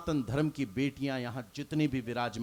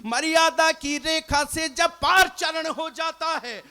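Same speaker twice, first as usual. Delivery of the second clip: higher, louder ringing verse to the crowd; loud declamation; 11 semitones higher, 10 dB louder than usual. Acted. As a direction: belt the couplets out like a stage poet at full force. Poet builds verse to a climax.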